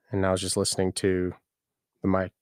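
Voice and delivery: usual speaking voice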